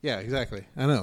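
ordinary talk, nothing marked

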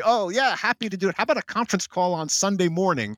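high, eager mock enthusiasm; mocking macho bravado; mock macho eagerness. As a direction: overeager yes-man, hammed-up. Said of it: "out macho themselves to say".

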